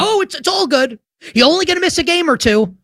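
high-pitched breezy imitation; mimicking insiders brushing it off; mock reassurance. Mimicking dismissive insiders' reports.

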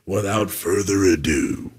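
slow, booming big announcer build-up; announcer intro. Acted. Host introduces his ranking segment, booming announcer voice. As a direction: hype the segment like a ring announcer.

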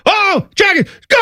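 high-pitched mock announcer yell; hammed-up radio call, way up high; imitating an excited announcer. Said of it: "he'd be screaming".

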